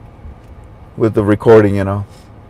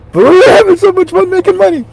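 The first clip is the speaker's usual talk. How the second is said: pitched well up, pleading; high whiny Wall Street; 14 semitones higher than usual. Retold or imitated. Wall Street begging to keep having fun, high whine.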